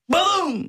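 crash noise, said high; imitated crash. Guest imitates the bump from behind. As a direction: voice the car hitting him.

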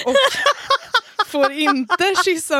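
laughing, high and loud. Real, not acted. Friend complaining about the date, laughing through it.

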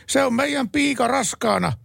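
high, slow, shrill-pitched housewife outburst; indignant wife. Acted. Wife bursting in on her husband, high indignant voice. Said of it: "Emäntä tuohtuneena".

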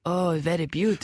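joining in with mock French English; French accent. Shown as a demonstration. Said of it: "the French people".

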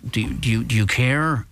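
normal talking voice

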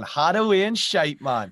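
high, punchy Geordie model; Geordie accent. Shown as a demonstration. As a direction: show how a Geordie says it. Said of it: "try this one".

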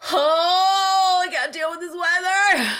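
exasperated wail. Real, not acted. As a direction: wail it out, fed up with the weather. Wailing complaint about the heat.

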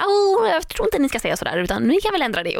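high, fussy mock-polite executive; mocking TV boss. Mocking a TV channel boss who wants changes, high fussy voice. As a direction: ham up the meddling channel boss. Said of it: "bajsnödigt ibland att så här".

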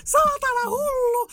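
high, crying-out furious scream at him; furious yell. Retold or imitated. Woken from paralysis, yelling at the man in terror and rage. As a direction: scream at the man who just choked you. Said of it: "mä oon tälleen, että".

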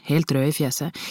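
usual speaking voice

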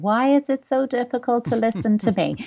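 hammed-up weary parent question; exasperated parent. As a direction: play the fed-up parent pleading to be heard. Exasperated parent asking child.

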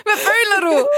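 high, loud laughing burst; playful over-eager interviewer shout; mock-excited questioning. Teasing the prize winner about how it feels, mock-excited.

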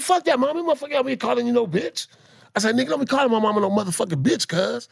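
high, fast heated protest; heated teen. Retold or imitated. Son defending mama against the man, high and heated. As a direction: fire back at him.

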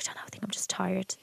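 quick, hushed whisper; retold meek reply. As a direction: whisper a meek little excuse. Retelling feeble reply to partner.

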